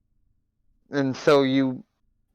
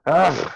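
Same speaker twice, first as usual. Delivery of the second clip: exasperated huffing "ugh"; huffy groan; 6 semitones higher, 8 dB louder than usual. Acted. Stomping out of the room, huffy groan. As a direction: huff it out before storming off. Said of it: "I just huff and puff".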